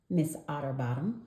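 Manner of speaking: usual speaking voice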